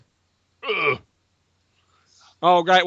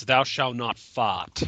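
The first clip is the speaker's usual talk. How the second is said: mock commandment; 5 semitones lower, 4 dB quieter, slower than usual. Parodying the commandment.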